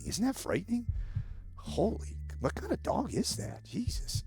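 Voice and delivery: high, incredulous disbelief; grossed out. Real, not acted. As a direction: recoil at how ugly it is. Mocking the ugly dog.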